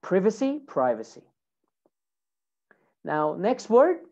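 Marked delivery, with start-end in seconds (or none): 0.0-0.6 British "privacy", first syllable as short "priv"; British accent. Shown as a demonstration. Teacher models British "privacy" for students, short I. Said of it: "In British English". 0.7-1.2 American "privacy", first syllable as "pry"; American accent. Shown as a demonstration. Teacher models American "privacy" for students, long first vowel. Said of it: "In American English".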